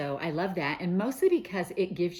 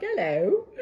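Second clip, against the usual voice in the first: hammed-up mock English accent, loud and laughing; a silly, put-on English accent with a laugh; terrible English accent; 6 semitones higher, 9 dB louder than usual. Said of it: "talk with that terrible English accent".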